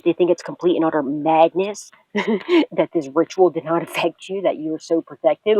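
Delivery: usual speaking voice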